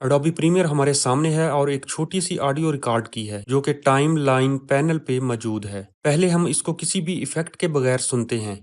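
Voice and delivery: usual speaking voice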